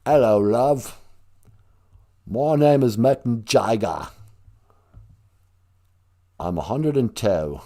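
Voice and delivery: lightly put-on English accent; hammed-up British rocker introducing himself in an English accent. Aging rock star greeting a fan, mock English accent. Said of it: "Whenever I do an English accent, I'm basically thinking of [name]".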